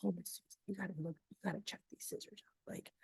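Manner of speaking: hushed secretive whisper; imitating a quiet introvert's whisper. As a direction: lean in and whisper the pitch. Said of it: "he would be like".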